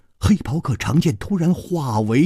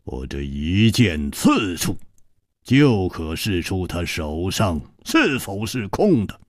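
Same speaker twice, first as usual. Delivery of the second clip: 低沉缓慢，语气严厉; 压着嗓子厉声威胁; 厉声的黑袍客; 7 semitones lower than usual. A narrator's reading. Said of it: "黑袍客厉声说". (黑袍客厉声威胁楚留香，低沉严厉)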